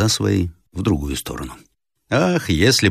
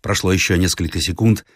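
ordinary delivery, nothing marked

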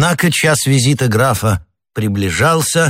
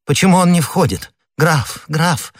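high, agitated questioning; understated tremulous impatience; trembling voice; 7 semitones higher than usual. A narrator's reading. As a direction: call out anxiously, pitched up. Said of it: "спросила миледи дрожащим голосом".

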